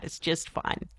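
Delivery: whispering "It's just fine" under breath; unvoiced whisper of "It's just fine". Real, not acted. Repeats the verdict, then whispers it.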